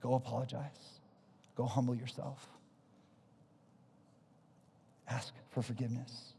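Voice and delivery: God, low and soft, lightly voiced; a hint of a quiet inner voice of God correcting him; God's gentle voice. Preacher voicing God nudging him to apologize, low and soft. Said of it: "And then I hear God saying".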